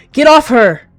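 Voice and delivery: high, very loud command; furious shout. A narrator's reading. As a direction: roar it out to rescue her. Cat attacks to rescue another.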